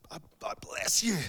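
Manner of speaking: strained high whisper; grudging mock blessing. Driver cut off in the car, grudging whispered blessing. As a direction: force the blessing out in a tight, whispery voice. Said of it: "Not with, not with sulfur".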